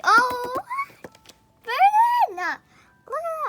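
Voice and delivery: high, loud squeal of delight; excited shriek. Real, not acted. Fan spotting a favourite photo, excited shriek. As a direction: shriek the name in sudden joy.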